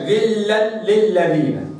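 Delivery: "غلا للذين" slow, each lam clear; six lams recited. Shown as a demonstration. Teacher reciting "غلا للذين" to sound all six lams. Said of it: "في قوله تبارك وتعالى".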